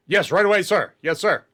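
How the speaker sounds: rattled soldier answering fast; flustered guard. Acted. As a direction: jump to it for the officer, yes-sir. Guard snaps to answer officer.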